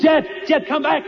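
high, urgent shout after a friend; frantic high-pitched holler; alarmed shout. Acted. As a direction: yell to call someone back from danger. Hatch closing on companions, calling out.

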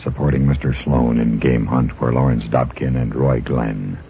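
ordinary talk, nothing marked

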